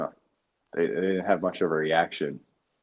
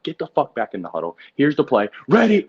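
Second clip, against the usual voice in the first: rising, louder and higher into a sharp ready; huddle call; 8 semitones higher, 8 dB louder than usual. Retold or imitated. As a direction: fire up the huddle. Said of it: "So it's like".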